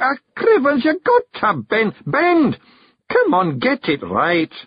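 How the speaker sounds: high Scots voice, bossing his own limbs; exasperated high-pitched Scottish orders; bearded stranger, flustered. A narrator's reading. Stranger ordering his own body onto the cart, flustered high Scots.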